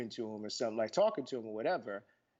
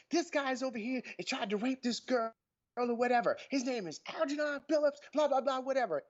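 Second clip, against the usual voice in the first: mock phone report; 11 semitones higher than usual. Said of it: "He goes".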